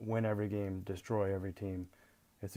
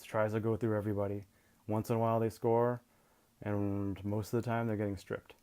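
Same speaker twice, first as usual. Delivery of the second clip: normal talking voice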